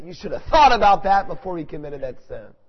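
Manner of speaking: loud, high burst of reproof; stern rebuke. Acted. Preacher answering an objection about hardship, stern loud rebuke. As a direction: come down hard on the objector.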